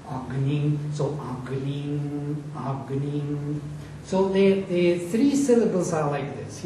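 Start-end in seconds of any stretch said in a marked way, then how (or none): 0.1-3.6 "agnim" slow, low and softer, first syllable kept down; low-pitched first syllable. Shown as a demonstration. Teacher showing how low pitch makes accent audible, low intoned agnim. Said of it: "you have to put this into the low pitch".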